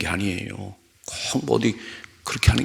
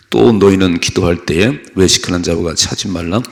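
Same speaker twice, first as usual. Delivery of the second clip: scripture reading; 3 semitones lower, 4 dB louder than usual. Acted. Preacher reading Matthew aloud, loud and measured. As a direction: read it out to the congregation.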